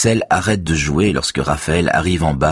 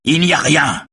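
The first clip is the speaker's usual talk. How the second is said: high-pitched indignant cry; shouting; 10 semitones higher than usual. A narrator's reading. Raphaël insists there is nothing, high cry. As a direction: burst out much higher. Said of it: "crie-t-il".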